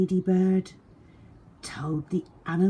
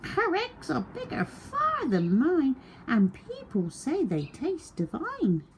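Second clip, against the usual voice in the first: very high clucking hen; high fussy hen; 13 semitones higher than usual. A narrator's reading. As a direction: sell out the duck in a hen's voice. Hen pleads.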